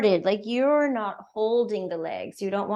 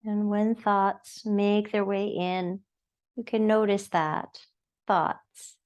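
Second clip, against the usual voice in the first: unhurried, soothing yoga-class guidance; guided meditation; 2 semitones lower than usual. Acted. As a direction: guide them gently into noticing the breath. Leads breathing meditation.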